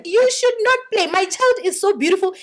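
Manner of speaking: high, proud doting mother gushing; mom's proud voice. Retold or imitated. Mother boasting to guests about her daughter, high and proud. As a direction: gush over the child like a proud mom. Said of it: "my mom would be like".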